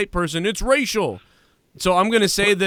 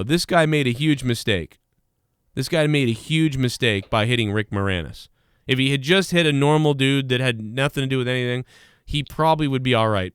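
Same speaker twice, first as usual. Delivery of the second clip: ordinary talk, nothing marked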